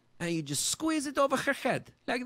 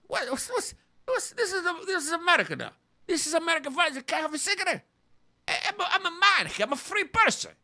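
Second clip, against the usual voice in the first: indignant outrage; 4 semitones higher, faster than usual. Acted. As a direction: rant like your rights are stolen. Indignant about no smoking.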